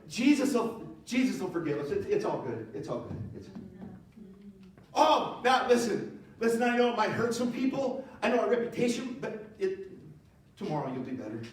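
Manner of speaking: rationalizing. Retold or imitated. A boy excusing bowing to the statue, wheedling. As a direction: talk yourself into compromise. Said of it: "to go".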